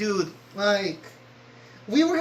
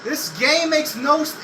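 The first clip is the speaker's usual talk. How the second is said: normal talking voice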